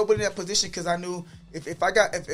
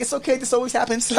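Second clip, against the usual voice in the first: laughing; 7 semitones higher than usual. Retold or imitated. Reassuring store workers, laughing.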